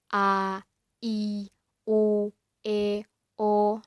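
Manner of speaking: each vowel A, I, U, E, O said loud and separate; clear vowels. Shown as a demonstration. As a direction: spell out "A, I, U, E, O" crisply and loudly, one by one. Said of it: "Jadi harus jelas".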